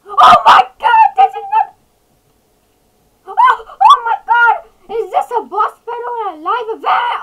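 high, loud frantic outburst; panicked shouting. Real, not acted. Player seeing another meteor arrive, panicked shouting. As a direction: shout it out in alarm.

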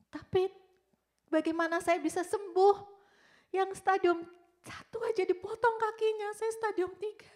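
high, loud, distraught questioning; desperate protest. Retold or imitated. Patient fearing for herself after friend's amputation, desperate protest. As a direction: let alarm push the voice up.

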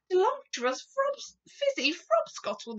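high, loud giant voice; eager giant. A narrator's reading. Giant answers the Queen eagerly.

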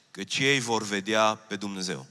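ordinary delivery

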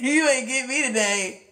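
slow, defiant words through laughter; laughing it off. Acted. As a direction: laugh in the face of the bad mood. Laughing off a bad mood.

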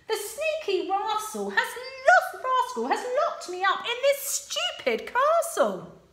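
high, loud and quick; impatient Sue. Acted. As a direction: hurry him along. Sue complaining to dragon.

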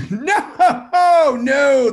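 high, loud laughing refusal; amused loud no with a laugh. Retold or imitated.

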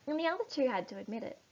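ordinary delivery, nothing marked

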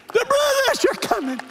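high, full-force alarm shout; Paul Revere. Pretend. Preacher playing a rider through the city, shouting. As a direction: holler it like a rider warning the town. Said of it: "I feel like Paul Revere".

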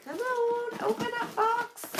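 high, small coaxing voice; high-pitched wheedling while struggling. Real, not acted. Struggling to open a taped box.

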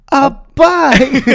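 squeaky high answer, loud; high cartoonish squeal; high silly voice. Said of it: "just like".

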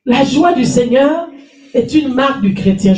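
usual speaking voice

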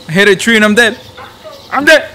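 loud high frustrated yell; raging gamer. Real, not acted. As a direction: yell at the screen after dying. Dies hitting a tree.

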